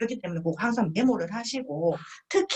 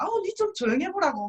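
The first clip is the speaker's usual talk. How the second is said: higher, half-joking shush; mock hushing scold; 10 semitones higher than usual. Partner hushing the guest, mock scolding.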